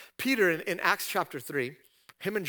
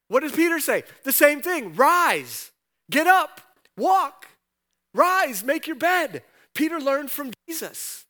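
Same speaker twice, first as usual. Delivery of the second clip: higher and louder, building to ringing commands; preaching at full force; 7 semitones higher, 6 dB louder than usual. Acted. Pastor driving the point home to the congregation, fervent and loud. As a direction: climb to a fervent preacher's cry, then hammer the commands.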